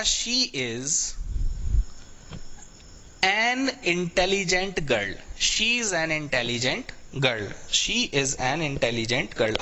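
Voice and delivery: very slow, word by word; slow model reading. Shown as a demonstration. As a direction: read it out slowly for the class. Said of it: "teacher models sentence slowly".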